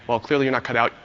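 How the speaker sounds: ordinary talk, nothing marked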